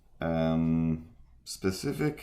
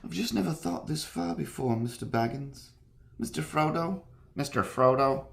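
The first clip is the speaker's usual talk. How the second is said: high, soft hobbit voice, searching for the character; Samwise; 6 semitones higher, 5 dB quieter than usual. Acted. Trying out the loyal hobbit addressing Mister Frodo, Samwise impression. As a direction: put on a hushed, higher-pitched loyal companion. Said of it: "How does Samwise sound?".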